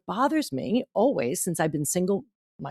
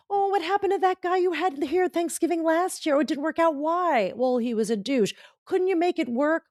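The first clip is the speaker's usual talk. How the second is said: nosy relatives; 11 semitones higher than usual. Retold or imitated. Relatives quizzing at Thanksgiving.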